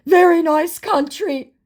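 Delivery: high, slow and gushing; mocking the scammer's empty praise, high and slow. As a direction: drawl it out like a clueless fake. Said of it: "said my scammer".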